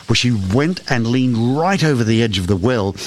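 ordinary delivery

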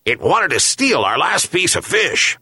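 high-pitched accusing yelp; yelping dog. A narrator's reading. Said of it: "and Boli yelped".

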